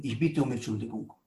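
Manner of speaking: low, soft, humble apology; acted contrite murmur; subdued apology. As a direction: apologize quietly, voice dropped right down.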